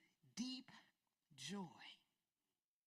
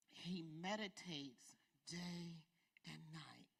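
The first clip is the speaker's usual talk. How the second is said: lower, softer, slow; soft scripture reading; 8 semitones lower, 7 dB quieter, slower than usual. Acted. Preacher reads the verse quietly, reverent. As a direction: read it hushed and reverent.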